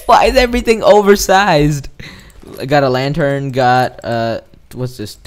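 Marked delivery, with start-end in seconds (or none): 0.1-1.9 high-pitched complaint; exasperated. Real, not acted. Exasperated at the game.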